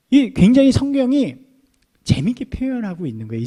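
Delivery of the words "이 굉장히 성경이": ordinary talk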